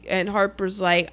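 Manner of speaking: ordinary delivery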